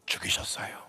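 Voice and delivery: 죽이셨어요 breathed out in a low whisper. Acted. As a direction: let 죽이셨어요 sink to a hushed whisper. Preacher drops to a whisper. (죽이셨어요를 낮게 속삭이듯)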